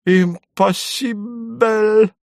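high, slow squeal of alarm; shrill panicked foreign exclamation; frightened Vybegallo. Acted. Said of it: "испуганно прошептал Выбегало".

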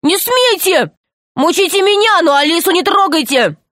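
put-on high, impassioned boy's protest; acted high outcry; desperate cry. A narrator's reading. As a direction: plead and forbid at once, voice pitched right up. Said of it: "закричал Пашка".

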